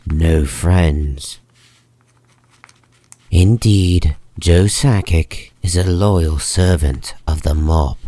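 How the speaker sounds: ordinary talk, nothing marked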